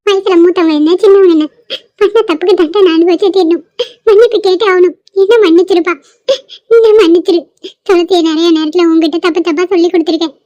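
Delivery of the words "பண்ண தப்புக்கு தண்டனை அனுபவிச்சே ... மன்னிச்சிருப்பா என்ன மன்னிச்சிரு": normal talking voice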